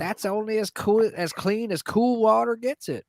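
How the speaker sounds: high put-on uncle voice; imitated uncle's punchy reply; Uncle Dickie. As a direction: answer high and folksy, telling a joke. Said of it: "joke my Uncle Dickie told me".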